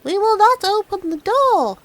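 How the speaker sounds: put-on high, loud kids' refusal; little kids shouting back at the wolf; kids crying out. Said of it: "they cried out".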